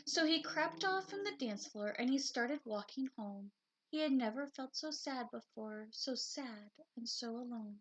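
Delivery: lively storybook narration; reading to children. A narrator's reading. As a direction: read it like a bedtime picture book.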